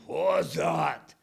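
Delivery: loud gruff yell from inside; big voice yelling. Retold or imitated. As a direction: bellow it at the door. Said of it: "I heard the big voice yelling".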